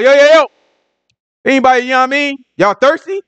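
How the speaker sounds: loud, high mock hail "Yo, yo, yo"; calling out. Jesus hailing the thirsty crowd, loud mock street call. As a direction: holler it to grab a crowd.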